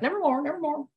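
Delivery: high, comic bird squawk; parroting bird. Acted. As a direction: parrot it back high-pitched. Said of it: "finally the bird's like".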